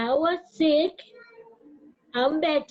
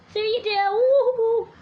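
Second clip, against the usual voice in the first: excited whoop; 7 semitones higher, 6 dB louder, slower than usual. Real, not acted. Hyping herself on live.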